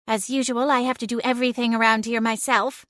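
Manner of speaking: fast, weary grumble; exasperated sigh. Acted. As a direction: grumble it like a put-upon cat. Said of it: "Celine sighed".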